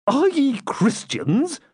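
high, slow, scandalized question; aghast, drawing it out; shocked disbelief. Acted. Prior shocked by outlaws' language.

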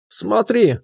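eager high call to look; enthusiastic. A narrator's reading. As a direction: pull him in with excitement. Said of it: "увлечённо говорил Руслан". (увлечённо, с азартом)

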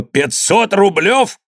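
high, drawn-out exclamation of outrage; indignant cry. A narrator's reading. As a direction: echo the sum in outraged disbelief. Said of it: "крикнул Иван". (возмущённый крик, протяжно и высоко)

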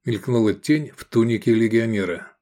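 ordinary delivery

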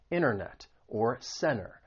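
internet and center with silent T after N; dropped T. Shown as a demonstration. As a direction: say "innernet" and "cenner" for internet, center. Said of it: "it may elide and it gets removed".

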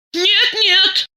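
high-pitched, stubborn protest; insistent. A narrator's reading. Diana refuses to accept the accusation, insistent. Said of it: "стояла на своём Диана".

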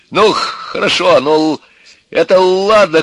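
high, loud, angry shout; shouting father. A narrator's reading. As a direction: yell it like an exasperated father. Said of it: "кричал отец". (громкий крик отца)

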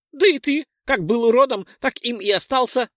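high loud teasing pixie; mocking pixie. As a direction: jab it back squeakily. (насмешливая пикси)

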